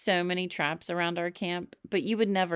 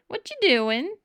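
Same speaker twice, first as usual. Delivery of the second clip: surprise voice; 11 semitones higher, 5 dB louder than usual. A narrator's reading. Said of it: "said a voice".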